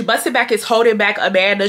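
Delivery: ordinary talk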